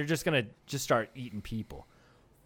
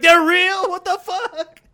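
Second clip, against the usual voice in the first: put-on high, loud squeal of disbelief from the kid; shocked kid; 11 dB louder, slower than usual. Kid learning meat bees are real, shrieks in shock. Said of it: "He's like".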